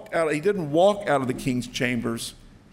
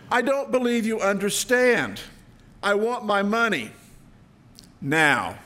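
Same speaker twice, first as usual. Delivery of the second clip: louder, higher demand for payment; demanding king; 6 semitones higher, 3 dB louder than usual. Acted. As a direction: insist like an impatient king. King demands money now.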